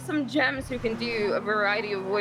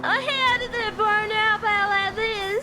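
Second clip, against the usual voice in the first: silly put-on voice; 12 semitones higher, 4 dB louder, much slower than usual. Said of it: "you're not the only one that can't do voices".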